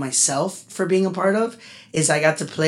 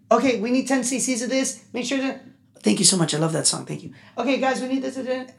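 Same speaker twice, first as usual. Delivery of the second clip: busy nurse; 7 semitones higher than usual. Retold or imitated. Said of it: "they would like".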